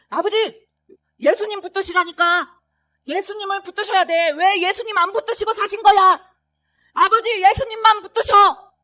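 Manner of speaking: high, loud pleading; earnest shouted plea. Retold or imitated. Son pleading on the last visit, earnest loud shout. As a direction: plead with him as a last chance. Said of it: "이렇게 간절하게 외쳤는데".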